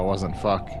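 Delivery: ordinary talk, nothing marked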